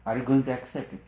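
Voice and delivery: ordinary talk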